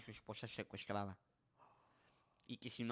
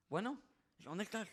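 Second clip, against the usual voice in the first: high, anxious phone greeting; anxious call; 8 semitones higher than usual. Retold or imitated. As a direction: ask where she is, worried. Husband anxiously phoning wife. (saludo telefónico ansioso)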